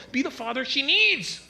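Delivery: high, rising insistent demand; acted impatient outburst; exasperated wife. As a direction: scold it with climbing pitch.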